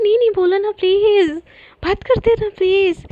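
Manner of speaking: insistent, whiny-sweet begging; pleading. Acted. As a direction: beg them to keep talking. Acted begging friend to talk.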